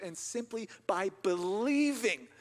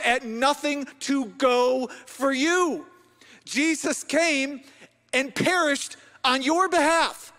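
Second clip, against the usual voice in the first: loud, high-pitched, emphatic preaching; full-force preaching; 6 semitones higher, 8 dB louder than usual. Real, not acted. Preacher telling the congregation Jesus went to the cross for them, full-force preaching. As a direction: proclaim it to the crowd with stretched key words.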